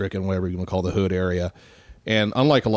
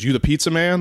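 higher challenging question; tough guy; 8 semitones higher, 3 dB louder than usual. Retold or imitated. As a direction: size him up. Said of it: "one guy goes".

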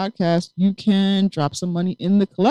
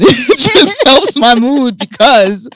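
laughing; 6 semitones higher, 10 dB louder, slower than usual. Real, not acted. Laughing at own joke.

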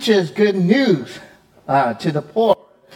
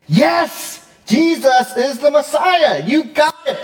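triumphant yes; 10 semitones higher, slower than usual. Pretend. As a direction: cheer like the penny finally dropped. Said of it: "we're finally like".

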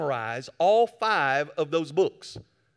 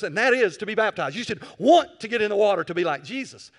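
rising, louder preacher's exhortation, slowed on the key words; preaching; 3 semitones higher, 5 dB louder than usual. Acted. Preacher urging his congregation toward baptism, louder and insistent. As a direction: press the church to want baptism.

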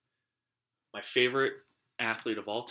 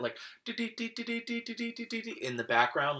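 high, slow ditty-ditty radio tone noise; imitated broadcast ticker; 11 semitones higher, much slower than usual. Recalling snow-day radio announcements, mock radio jingle. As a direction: mimic the old radio closing-list jingle.

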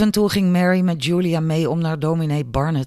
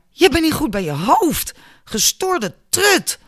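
very high, angry outburst; outraged insult; 11 semitones higher than usual. A narrator's reading. Albert rages at Julia, high and furious. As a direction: hurl the insult, drawing out the last words. Wounded bully insults girl.

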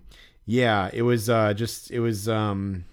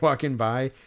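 ordinary delivery

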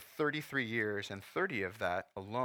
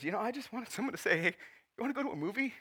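higher, tearful voice quoting mom; holding back tears; 10 semitones higher than usual. Real, not acted. Pastor quoting his widowed mother to the church, near tears. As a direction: fight the tears as you say it. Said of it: "I'm trying not to cry".

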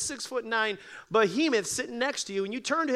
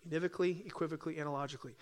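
fading rundown of univocally, equivocally, analogically, softer each time; quick soft repeat; 3 semitones lower, 8 dB quieter, much faster than usual. Shown as a demonstration. To the congregation, a soft quick echo of the three words. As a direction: rattle off univocally, equivocally, analogically under the breath. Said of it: "we use words three ways".

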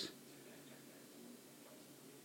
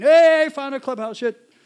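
loud, higher-pitched hey to friends; excited calling out; 5 semitones higher, 9 dB louder than usual. Retold or imitated. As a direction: share a great find.

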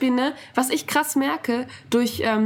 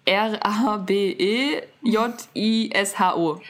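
slow letter-by-letter spelling; much slower than usual. Shown as a demonstration. Host spells an app name for listeners, slow spelling. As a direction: spell it slowly, one letter at a time.

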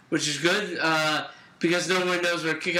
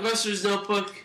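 usual speaking voice

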